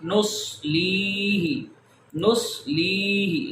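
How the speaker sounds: نسلیہ slow, with lee held long; long vowel. Shown as a demonstration. Teacher drills ya madda, نسلیہ drawn out. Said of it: "لام کو لمبا کریں گے".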